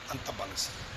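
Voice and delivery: అంత బలశాలి dropped low and soft; hushed echo. Shown as a demonstration. Preacher marvelling at uncle's strength, hushed echo. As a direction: let it fade to a near-whisper.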